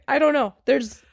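ordinary talk, nothing marked

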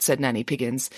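ordinary delivery

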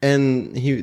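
usual speaking voice

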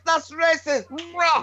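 high-pitched mock outrage; son's slang voice. Dad mimicking his son's comeback, mock affronted. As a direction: imitate an affronted slang-talking lad. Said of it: "that's how my son talks now".

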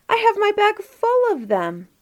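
put-on very high-pitched boyish exclamation; proudly insist, voice soaring; excited boast. A narrator's reading. Inge answering the doubting children, excited boast.

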